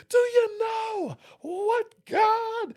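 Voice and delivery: very high, slow, loud preaching shout; preacher climax. Retold or imitated. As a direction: hit the top of the sermon. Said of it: "by the end of that thing".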